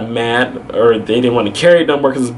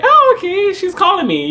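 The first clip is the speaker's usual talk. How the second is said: high-pitched little reaction; lightly acted "oh, okay"; retold mild surprise. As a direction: lift it high, noticing the call. Retold reaction to incoming call.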